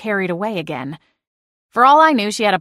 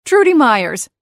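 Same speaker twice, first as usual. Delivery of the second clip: a louder, crisp matron's introduction; brisk introduction; 4 semitones higher, 6 dB louder than usual. Acted. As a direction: announce her name, businesslike.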